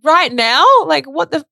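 high, loud disbelieving question; retold shock. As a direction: imitate a startled, incredulous reaction. Therapist's retold shocked reply.

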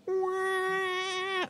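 high, soft, sustained "waah"; imitated filter effect. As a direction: mimic a garbled movie sound effect. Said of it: "kinda garbled with an audio filter".

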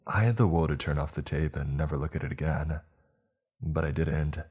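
plain reading voice

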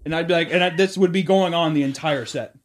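normal talking voice

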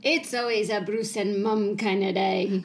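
a light put-on British cook's accent, a bit slow; half-hearted British accent like a Downton cook; playful British accent, lightly done; mock Mrs Patmore. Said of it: "my accent had been, like, Missus Patmore".

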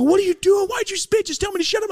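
squeaky high indignant friend; high disgusted protest. Retold or imitated. Friend protesting the spit, high and disgusted. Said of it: "And they go".

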